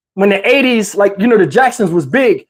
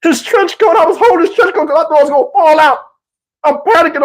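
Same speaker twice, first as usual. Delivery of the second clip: usual speaking voice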